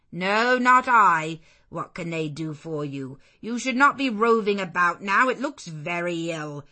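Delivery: loud, sharp scolding of a vagrant; stern refusal. Acted. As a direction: bark it at the stranger on the step. Servant refusing the beggar.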